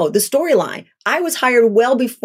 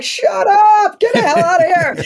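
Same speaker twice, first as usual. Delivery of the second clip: high, loud, stunned squeal; retold joyful disbelief; shocked disbelief; 11 semitones higher, 4 dB louder than usual. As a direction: react like you can't believe the news. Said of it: "I'm like".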